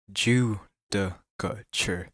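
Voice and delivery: Judicature spelled out slowly, syllable by syllable; slow careful try. Shown as a demonstration. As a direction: drag out Judicature, sounding out each part. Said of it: "I'm already failing".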